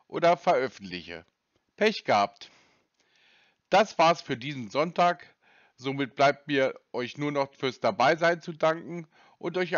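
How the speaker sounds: slow, low podcast sign-off; slow relaxed host outro voice; calm outro narration. Acted. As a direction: wrap up the episode calmly for listeners. Host's closing outro narration.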